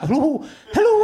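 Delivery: hammed-up comedy Scottish accent, voiced; Ian McCaskill comedy Scottish. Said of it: "a comedy Scottish accent".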